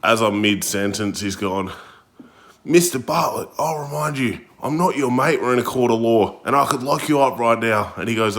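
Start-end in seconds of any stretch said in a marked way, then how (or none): 2.6-7.9 imitating stern judge. Angry judge rebuking defendant.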